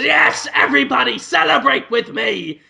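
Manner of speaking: rally the room in mock triumph; Willem Dafoe. Boss celebrating with his staff, triumphant call. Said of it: "And Willem Dafoe says".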